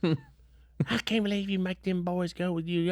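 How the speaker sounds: high, slow mock mother's complaint; mother griping about the boys being taken along; griping mom. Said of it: "Mom was griping".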